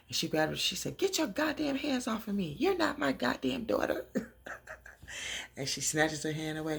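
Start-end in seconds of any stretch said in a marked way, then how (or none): 1.0-4.1 high-pitched indignant squawk; acted outrage. Mama Rose rejects Leah's grip, high-pitched mock outrage. 4.1-5.5 soft voiceless chuckle; laugh. Real, not acted. Amused by her own impersonation, soft laugh. Laughs after acting outburst.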